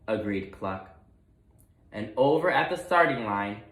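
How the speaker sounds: usual speaking voice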